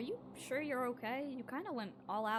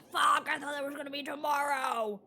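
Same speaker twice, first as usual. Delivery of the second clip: frustrated shout; 3 semitones higher, 5 dB louder than usual. Real, not acted. Frustrated outburst at the game.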